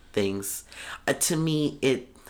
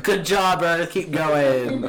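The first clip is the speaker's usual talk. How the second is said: mock cheering; 5 semitones higher, 5 dB louder, slower than usual.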